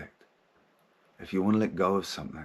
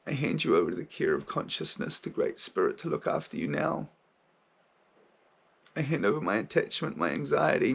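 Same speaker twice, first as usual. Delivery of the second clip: put-on lightly higher, tender child farewell; inner child voice; 8 semitones higher than usual. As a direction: let go with a child's sorrowful love. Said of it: "in that voice I've just been using".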